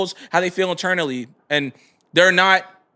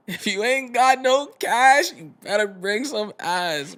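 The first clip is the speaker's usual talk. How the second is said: mock slogan, slow; 4 semitones higher, much slower than usual. Slow rhyming joke delivery.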